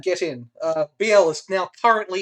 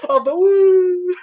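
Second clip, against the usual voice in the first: very high, loud drawn-out wail; silly droid voice stretched into a long "woo"; 12 semitones higher, 8 dB louder, much slower than usual. Pretend. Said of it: "what's he saying?".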